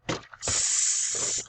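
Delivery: S as a soft hiss; sound s. Shown as a demonstration. Teacher sounds out See again. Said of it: "So let's sound that out".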